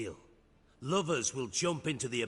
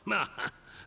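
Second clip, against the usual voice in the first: short knowing chuckle; smug laugh; 8 semitones higher, 3 dB louder than usual. Acted. Crime boss laughs smugly at the two lovers. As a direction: laugh once, pleased with yourself.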